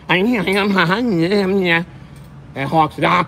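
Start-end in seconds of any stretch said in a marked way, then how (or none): 0.1-1.8 a squeaky, put-on voice, a little higher; light squeaky-voice mockery with a chuckle; mocking squeaky voice. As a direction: mimic the rival's squeaky voice, laughing. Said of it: "that guy with that squeaky ass voice".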